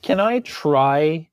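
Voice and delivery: ordinary talk, nothing marked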